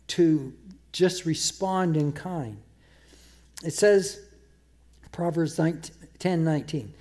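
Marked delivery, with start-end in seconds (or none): none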